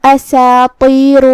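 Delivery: اساطیر said slowly with a light, thin seen. Shown as a demonstration. Teacher shows the light seen in a slow, drawn-out demo. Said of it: "ہمیں اسے ہلکی آواز میں پڑھنا ہے".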